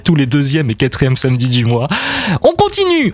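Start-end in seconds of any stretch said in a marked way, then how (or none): 2.4-3.1 lively announcement. Real, not acted. Radio host announcing next track.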